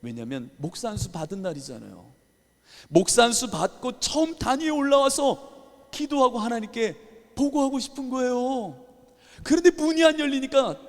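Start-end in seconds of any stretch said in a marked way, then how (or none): 2.9-10.8 preaching at full force. Acted. Preacher telling congregation his anger, loud impassioned preaching. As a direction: pour the frustration out over the pulpit.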